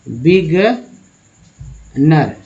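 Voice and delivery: बिगनर drawn out very slowly with only a light r at the end; pronunciation demo. Shown as a demonstration. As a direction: stretch बिगनर, barely touching the final r. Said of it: "बिगनर रखिए".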